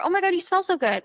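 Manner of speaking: high, bright excited compliment; gushing compliment. Shown as a demonstration. A dating coach demonstrating a compliment for the date, gushing. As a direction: gush over his outfit. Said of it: "Here are some of my go-to compliments".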